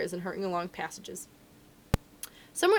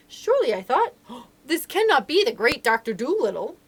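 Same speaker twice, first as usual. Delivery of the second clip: astonished; 3 semitones higher, 6 dB louder than usual. A narrator's reading. Narrator realizing who the little man is, astonished. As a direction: punch it in amazed disbelief.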